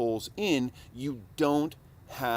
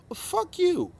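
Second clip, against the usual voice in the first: defiant retort; 4 dB louder, slower than usual. Retold or imitated. Retort to complainers.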